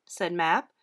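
plain reading voice

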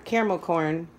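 ordinary delivery

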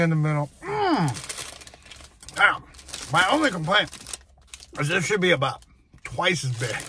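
eating while speaking; talking while chewing. Real, not acted. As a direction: mouth partly full of food. Seen 1: holds fry throughout, bites at 3.3, chews after.

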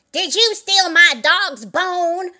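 loud, high, slow demand; accusing cry. A narrator's reading. Old Mother Hubbard confronting Little Jack Horner, loud accusing cry. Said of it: "cried Old Mother Hubbard".

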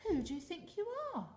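high, loud indignant fish; scornful and offended; haughty outraged cry. Acted. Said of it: "cried the rainbow fish".

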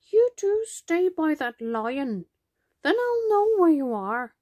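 high-pitched storybook grandma voice; tired grandma. A narrator's reading. Tired grandma instructing the children.

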